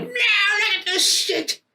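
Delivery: very high, slow mock wail; hammed-up agonized shriek; horrified wailing. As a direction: howl it in horror at your hands. Acted horror at bleeding hands.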